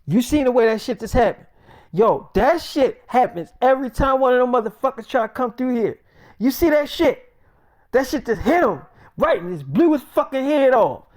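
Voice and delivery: high, loud and fast bragging; hyped shouting. Retold or imitated. Friend gushing to David about the kill, loud and high. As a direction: hype it up like a highlight reel.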